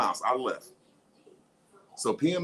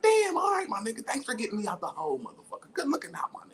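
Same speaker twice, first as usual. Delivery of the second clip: thankful mouse; 7 semitones higher than usual. Pretend. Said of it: "And I'm like".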